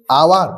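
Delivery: আওয়ার begun on the vowel; silent h. Shown as a demonstration. Teacher demonstrating how hour sounds like a vowel start, silent h. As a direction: say আওয়ার with no h at the front. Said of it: "এইচের উচ্চারণটা ভাওয়েলের মতো হচ্ছে".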